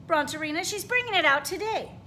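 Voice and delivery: lively storybook read-aloud with character voices; reading to children. A narrator's reading. Teacher reading a picture book to her class, animated. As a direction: read it like story time for little kids.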